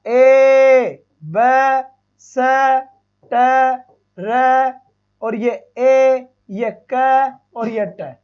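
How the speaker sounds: ए, ब, स, ट, र said apart, then slow; slow phoneme-by-phoneme recap; sound by sound. Shown as a demonstration. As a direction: spell the sounds one by one, unhurried. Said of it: "तो कैसे बनाऊंगा".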